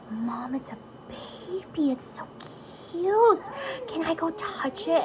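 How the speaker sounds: put-on high, stretched-out cooing kid; excited child's voice. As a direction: imitate a child gushing over a baby. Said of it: "they're like".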